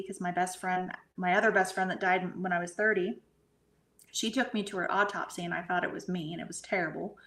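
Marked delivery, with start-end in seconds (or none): none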